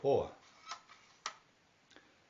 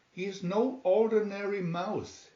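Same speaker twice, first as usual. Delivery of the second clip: high, excited exclamation; a proud father crying out; cried out; 7 semitones higher than usual. A narrator's reading. Old Mister Mouse amazed by his son, crying out. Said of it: "he cried".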